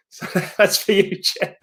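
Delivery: words breaking into real laughter; giggling while teasing a friend; laughing. Real, not acted. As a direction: laugh through the dedication. Laughing at his own joke.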